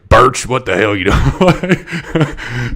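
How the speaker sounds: a slow, loud, half-acted drill-sergeant bark; mock sergeant major scolding. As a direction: imitate the old sergeant major chewing out a cadet, slowly. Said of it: "Just, like, scold me all the time".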